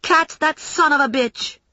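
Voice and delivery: high-pitched call to give chase; urgent shout. A narrator's reading. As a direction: spur a friend on from a distance. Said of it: "Mary Lou shouted at me".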